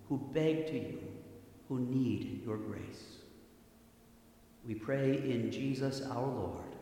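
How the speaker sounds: ordinary talk, nothing marked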